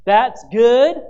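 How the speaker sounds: loud approving call. Retold or imitated. Churchgoer encouraging the preacher, loud call.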